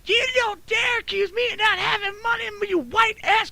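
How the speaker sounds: high-pitched imitation of an outraged lady; shrieky mock outrage; mock freak-out. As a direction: put on her indignant rant, way up high. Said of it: "that's when she freaked out".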